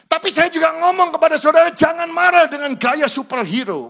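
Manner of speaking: loud, high fiery sermon voice; preaching at full force. Acted. Pastor urging husbands and wives, fiery preaching. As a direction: thunder the warning at the congregation.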